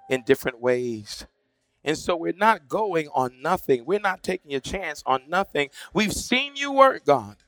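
public prayer building in force, then easing at the close; fervent prayer. Real, not acted. Preacher praying to God for those at the gate, fervently. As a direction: pray aloud with conviction, pressing each plea.

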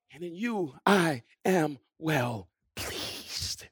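low, soft, very slow; hushed divine approval. Acted. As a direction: draw out each word tenderly with pauses. Quotes God's approval, dropping hushed.

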